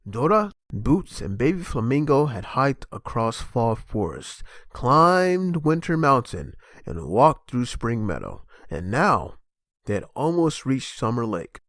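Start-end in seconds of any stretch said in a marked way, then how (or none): none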